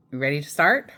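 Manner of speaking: plain reading voice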